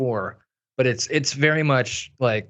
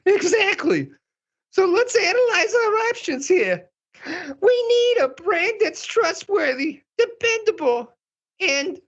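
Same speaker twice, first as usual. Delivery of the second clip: very high, eager drama queen. Acted. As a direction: chirp it like a busy diva. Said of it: "this is my drama queen voice".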